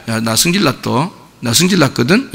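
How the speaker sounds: soft, low, barely cross; mild annoyance. Shown as a demonstration. Example of anger too weak for the older brother, mild annoyance. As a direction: understate the temper, almost offhand. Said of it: "이런 정도가 아니에요".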